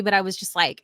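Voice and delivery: ordinary talk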